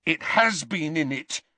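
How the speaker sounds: forceful, stung denial; a touch of teen fury; boy insisting he meant it, vehement. A narrator's reading. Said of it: "said Malfoy vehemently".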